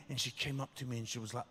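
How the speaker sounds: normal talking voice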